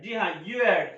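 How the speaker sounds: ordinary delivery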